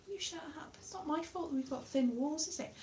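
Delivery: high, very soft comeback; hushed retort. Acted. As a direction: argue back at the neighbours without being overheard. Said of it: "somebody shouting at me".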